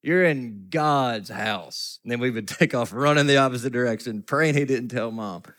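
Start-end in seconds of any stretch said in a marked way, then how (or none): 0.0-2.0 imitating a deacon's stern telling-off. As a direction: scold the boys like a stern church deacon. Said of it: "he would glare down at us and say".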